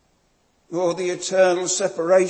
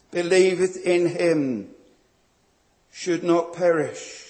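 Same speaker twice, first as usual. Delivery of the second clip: normal talking voice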